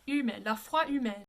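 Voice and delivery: La foi humaine, humaine as oo-MEN, silent H. Shown as a demonstration. Coach showing the silent French H. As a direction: drop the H in humaine. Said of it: "No H".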